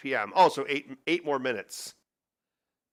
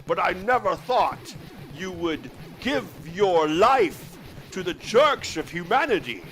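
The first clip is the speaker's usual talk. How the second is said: Sean Connery impression. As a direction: play Henry Jones Senior as Sean Connery, lisping every S. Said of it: "Talk like Sean Connery as Henry Jones Senior".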